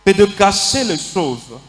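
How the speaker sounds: slow solemn reading. Acted. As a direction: weigh every word, slowly. Lecture lente et solennelle. (lecture lente et solennelle)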